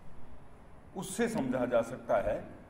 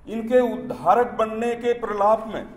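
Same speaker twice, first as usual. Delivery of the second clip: declaimed verse, higher and fuller than chat; poem recitation; 6 semitones higher, 8 dB louder than usual. Acted. Poet reciting satire on Dalit sympathy to an audience, declaimed recitation. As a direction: declaim it in measured phrases, lifting the line ends.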